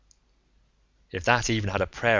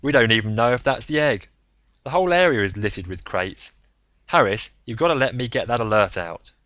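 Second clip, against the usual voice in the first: harsh, pleading; 3 semitones higher, 6 dB louder than usual. Acted. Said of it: "interjected harshly".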